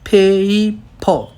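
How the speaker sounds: पेपर drawn out as "phey-ee-pho"; very slow पेपर, split syllables, silent r; Korean-style पेपर. Shown as a demonstration. Teacher spelling it out as Koreans write, slow "phey-ee-pho" for पेपर. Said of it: "Korean as it is उसको".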